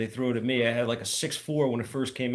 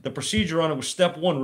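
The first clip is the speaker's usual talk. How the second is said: ordinary talk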